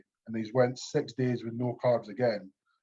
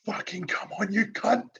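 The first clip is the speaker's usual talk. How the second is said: high-pitched mock yell, building at the end; retold scream; 7 semitones higher than usual. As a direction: re-enact screaming yourself on at the gym. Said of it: "I screamed it at the top of my lungs".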